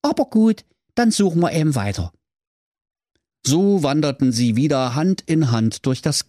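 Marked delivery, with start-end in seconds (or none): 0.0-2.1 put-on high, bright-eyed boyish character voice; Brudi voice. Brother musing to his sister, high boyish voice. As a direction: play the cheeky brother in a raised voice.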